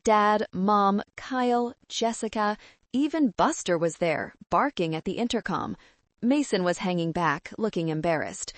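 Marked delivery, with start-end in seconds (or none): none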